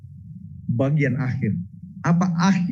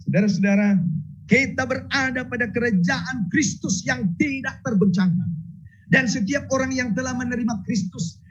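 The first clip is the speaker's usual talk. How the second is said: fervent preaching; 7 semitones higher than usual. Acted. Preaching at full force.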